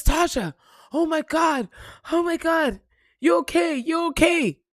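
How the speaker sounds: high, loud imitation of a worried coworker; alarmed coworker. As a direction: cry out in retold alarm, high and urgent. Coworker alarmed at her late arrival.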